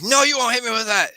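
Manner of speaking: high, loud cry of defiance; defiant shout. Acted. Game master voices foe blocking a crossbow shot, defiant shout. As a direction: shout it at an attacker. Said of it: "And he's like".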